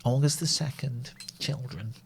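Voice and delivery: soft, mock-mournful diary reading; sad diary voice. Shown as a demonstration. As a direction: read the date with a hushed, put-on sadness. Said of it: "It was a sad diary voice".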